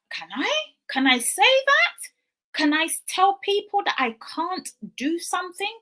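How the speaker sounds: high, rising disbelief; incredulous questioning. Retold or imitated. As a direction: ask as if it can't be allowed. Retells her disbelief at friend's permission.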